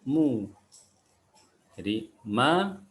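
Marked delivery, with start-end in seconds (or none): 0.0-0.5 "Mu" with lips pushed forward; rounded, protruded lips on "Mu"; pouted dhammah vowel. Shown as a demonstration. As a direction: pout into a clear U on "Mu". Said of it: "dengan memonyongkan bibir kita".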